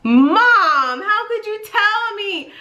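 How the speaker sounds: high, drawn-out wail of mock outrage; dismayed reproach. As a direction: act out a slow, high plea of disbelief. Retold dismay at spoiled secret.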